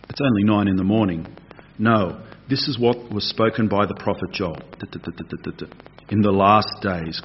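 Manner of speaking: low, soft Bible reading; scripture reading. Acted. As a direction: read the verses quietly and evenly. Reads Peter's answer from scripture.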